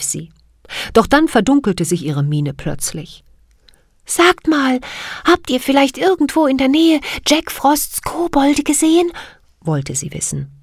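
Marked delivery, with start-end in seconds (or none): 4.0-9.1 very high, clear little fairy voice; bell-like fairy voice. Acted. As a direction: ring the words out like a tiny bell. Siri voiced in story.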